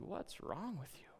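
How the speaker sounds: stern principal. Retold or imitated. Principal questioning Josh, soft and accusing. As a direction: ask it quietly and pointedly. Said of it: "The principal looked at Josh and said".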